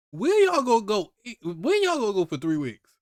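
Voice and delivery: loud, high mocking disbelief; incredulous mockery. Real, not acted. Mocking the villagers' escape plan, loud and incredulous. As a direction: scoff at the plan.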